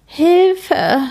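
put-on scared child calling for help; cry for help. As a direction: call out in fear. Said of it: "will Kim rufen".

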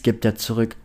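ordinary delivery

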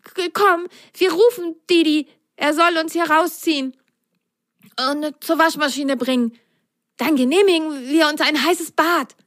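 high stammering sock voice; chattering, hopeful plan; shivery suggestion. Acted. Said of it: "schlug Patsch vor". (bibbernd vorschlagend)

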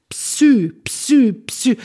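Psy repeated loud and high with a clear PS; exaggerated, separate bursts of Psy; drilling Psy. Shown as a demonstration. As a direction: sound both P and S in each Psy. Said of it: "Das ist doch nicht so schwer".

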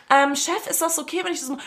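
hoch und leise werdend, zaghaft; schüchterner Kollege. Retold or imitated. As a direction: frag den Chef ganz vorsichtig um Erlaubnis. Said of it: "im ersten Moment so". (zaghafter Kollege, höher und immer leiser)

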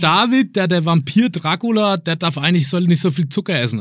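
lightly voiced little kid; little boy voice. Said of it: "Und dann sagt so ein kleiner Bengel".

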